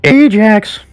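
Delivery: a sudden high, loud call of the name; high, loud herald's cry of Ajax; piercing call. A narrator's reading. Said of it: "In a piercing voice".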